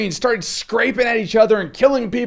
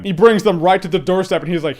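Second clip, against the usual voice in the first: normal talking voice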